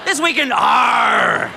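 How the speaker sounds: gruff pirate growl. Pretend. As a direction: bellow it like a pirate. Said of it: "sounds like a pirate magazine".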